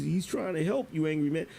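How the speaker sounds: normal talking voice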